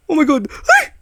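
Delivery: loud high cry of shock rising to a shriek; fright scream. Real, not acted. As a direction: scream at the jump scare. Sudden scare.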